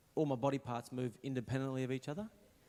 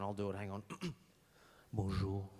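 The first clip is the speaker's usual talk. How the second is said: low, self-assured mock suave; throat cleared, then a cool low bonjour; playing it cool; 4 semitones lower than usual. Would-be French speaker showing off, mock cool. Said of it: "You think you're cool going".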